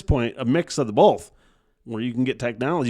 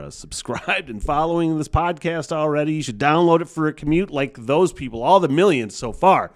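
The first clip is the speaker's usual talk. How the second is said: ordinary delivery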